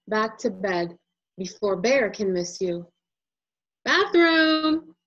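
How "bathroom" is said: put-on high, loud child announcing. Toddler announces bathroom, high and loud. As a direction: call it out like a kid. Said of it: "you say out loud".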